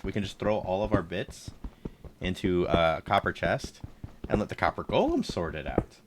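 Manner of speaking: normal talking voice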